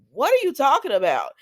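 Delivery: loud, high-pitched incredulous question; shocked disbelief. Retold or imitated. Retelling her reply to the leukemia news, loud shocked disbelief. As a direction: blurt it out, stunned, voice jumping up.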